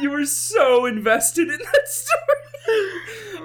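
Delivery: real high-pitched laughter breaking up words; cracking up. Real, not acted. Joke teller teasing his invested listener, cracking up. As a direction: talk through a big laughing fit.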